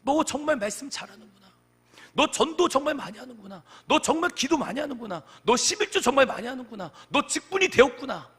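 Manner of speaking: loud, high-pitched acted divine praise; the Lord addressing a church. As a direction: proclaim each commendation forcefully, as the Lord. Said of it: "주님께서 그 에베소 교회에게 말씀하셨던 것처럼".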